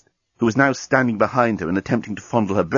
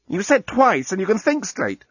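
high-pitched protest; objecting; 10 semitones higher than usual. A narrator's reading. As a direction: object in a raised, higher voice. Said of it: "objected Lucy".

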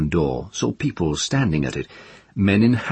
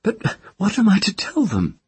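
demanding. A narrator's reading. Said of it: "she demanded".

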